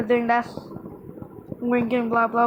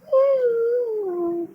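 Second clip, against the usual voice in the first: long, very high wail sliding down; high-pitched mock scream, held and falling; imitated scream; 12 semitones higher than usual. Customer screaming after her hair got curled, long high mock scream. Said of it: "she was screaming again".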